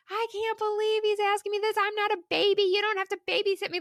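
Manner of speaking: imitating the husband griping, last line fast; whiny complaining husband. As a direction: play the offended husband, hammed-up. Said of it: "Like, all these complaints".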